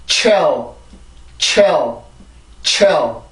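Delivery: chill said as "chel" with a falling dark L; pronunciation demo. Shown as a demonstration. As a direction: model chill as the stressed first syllable of challenge. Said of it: "앞에부터 해볼까요". (chill을 '채얼'처럼 강세 주어 발음)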